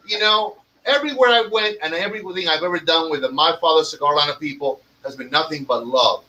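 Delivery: normal talking voice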